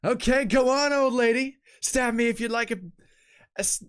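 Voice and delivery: high, loud dare; loud goading. Acted. Fighter daring an old lady to stab, goading. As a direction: egg them on at the top of the voice.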